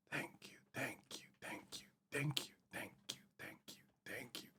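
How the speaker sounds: barely voiced rapid-fire thank-yous; whispered thanks. Real, not acted. Streamer thanking subscribers, whispered. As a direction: whisper it under your breath, over and over.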